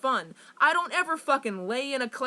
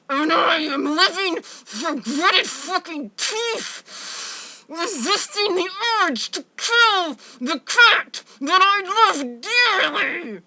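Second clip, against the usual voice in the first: much higher and louder, slow and strained; strained rage; 8 semitones higher, 9 dB louder, slower than usual. Real, not acted. Fighting the urge to hurt her cat, strained furious outburst. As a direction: force it out through rage.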